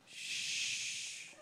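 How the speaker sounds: long hissed shush, imitated; passive-aggressive shush. Third person shushing a mother in the theater, imitated passive-aggressive shush. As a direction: imitate a moviegoer hushing someone. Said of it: "Shush is passive aggressive".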